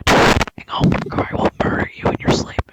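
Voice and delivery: hushed menacing whisper, fast; creepy whisper. Retold or imitated. Dad whispering a homework threat in her ear, menacing whisper. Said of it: "my dad whispering my, whispering in my ear".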